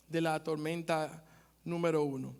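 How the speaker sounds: normal talking voice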